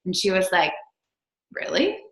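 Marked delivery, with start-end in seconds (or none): 1.5-2.0 high, rising, disbelieving question; a child's genuine surprise; surprised really. Retold or imitated. Daughter's surprised reaction voiced.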